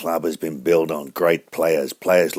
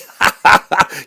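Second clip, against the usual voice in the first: cackling laugh; 11 dB louder than usual. Real, not acted. Host cracks up.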